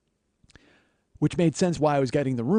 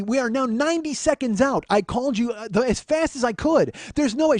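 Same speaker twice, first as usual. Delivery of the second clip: escalating rant; 8 semitones higher than usual. Retold or imitated. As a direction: climb in exasperation, cutting her off. Arguing with the agent.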